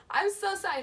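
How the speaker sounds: high, loud acted apology; freaking out. Terrified dreamer begging forgiveness in prayer, frantic. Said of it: "I was just freaking out".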